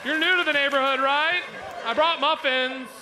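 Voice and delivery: high, lilting visitor's call; friendly neighbor act. Acted. Visitor calling to a new neighbor, high sing-out. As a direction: call in like a neighbor bearing muffins. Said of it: "But a carrot is more like".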